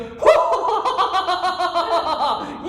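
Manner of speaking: long high, loud cackle; imitated laughing outburst. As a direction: burst out in mom's drawn-out hoot. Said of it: "And she goes".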